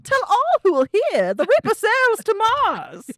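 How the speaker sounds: high, loud regal cartoon princess; Princess Donut voice. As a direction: declaim it like a haughty cat princess. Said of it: "with the Princess Donut's voice".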